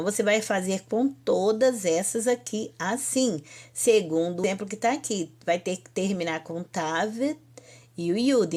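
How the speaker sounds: ordinary talk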